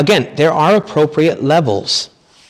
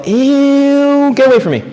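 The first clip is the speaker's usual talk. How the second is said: much higher, louder mock disgust; disgusted recoil; 14 semitones higher, 4 dB louder, slower than usual. Wife recoiling from the priest using her pet name. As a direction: recoil like someone got too close.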